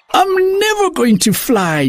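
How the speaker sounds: high, despairing wail; tearful. Acted. Daisy tells Mama she will never fly, crying. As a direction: cry it out to Mama. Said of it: "Daisy cried to Mama".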